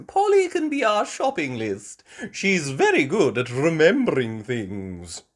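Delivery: put-on sweet old grandma asking; Granny Pig voice. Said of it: "Granny Pig said".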